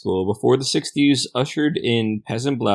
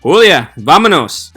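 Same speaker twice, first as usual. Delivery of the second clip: loud, high call across a parking lot; father's hollered summons in Spanish; 6 semitones higher, 9 dB louder than usual. Acted. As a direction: shout for your daughter from afar. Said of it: "father's voice calling out across the parking lot".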